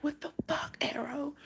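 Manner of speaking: hushed unvoiced shock; shocked whisper. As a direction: whisper it in disbelief. Shocked at bleeding cat, whispered.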